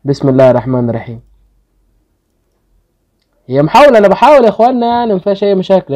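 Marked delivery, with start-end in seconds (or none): 3.5-5.9 excited pleading. Real, not acted. Hopeful gacha pull, pleading.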